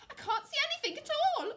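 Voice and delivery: high, fast chick voice; loud, squeaky stage whisper with full voice; mock whisper. A narrator's reading. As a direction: rush it out as a nervous chick. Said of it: "whispered Chicken Licken".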